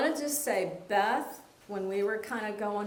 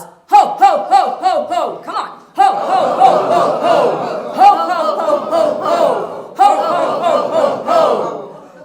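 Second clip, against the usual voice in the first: loud, booming ho ho ho, higher; Santa Claus laugh; 4 semitones higher, 13 dB louder, slower than usual. Shown as a demonstration. Laughter yoga leader doing a Santa Claus laugh. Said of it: "We're Santa Claus".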